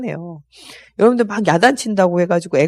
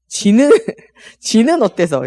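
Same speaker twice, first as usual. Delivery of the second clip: higher, drawn-out comeback with a laugh; mock defiant retort; 4 semitones higher, 4 dB louder than usual. Nagged spouse talking back to the nagger, mock defiant. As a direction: throw it back as a hammed-up sulky spouse.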